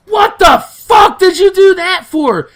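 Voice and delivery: high, slow, loud outraged yell; re-enacted angry shout; retold yell. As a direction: yell it at her in disbelief, drawn out. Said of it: "I did yell at her a little bit".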